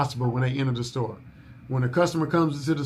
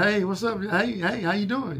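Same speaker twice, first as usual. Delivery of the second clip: upbeat phone greeting; 6 semitones higher than usual. Retold or imitated. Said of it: "I'm always like".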